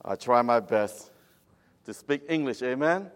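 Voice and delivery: Cambodian-accented English. Real, not acted. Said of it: "you can say Cambodian English".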